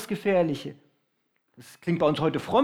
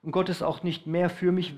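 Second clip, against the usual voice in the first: ordinary talk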